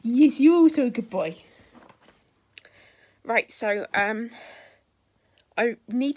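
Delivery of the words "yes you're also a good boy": high, loud praise for a dog; squeaky praising a dog. Real, not acted.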